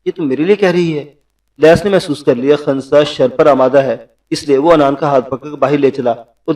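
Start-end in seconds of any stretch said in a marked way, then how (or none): none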